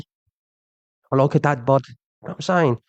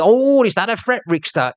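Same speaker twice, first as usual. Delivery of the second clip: higher, sneering "is that a threat"; mimicking a goading commenter; mock taunt; 6 semitones higher than usual. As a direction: toss it back like a smug troll. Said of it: "And that, you".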